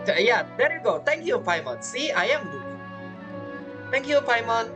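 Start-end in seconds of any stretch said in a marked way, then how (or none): none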